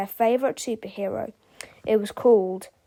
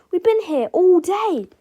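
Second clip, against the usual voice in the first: high, loud indignant voice; defiant protest; 9 semitones higher, 7 dB louder than usual. A narrator's reading. Harold defends both boys to the principal, protesting loudly. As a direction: protest with raised pitch.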